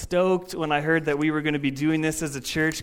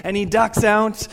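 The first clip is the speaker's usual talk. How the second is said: ordinary talk, nothing marked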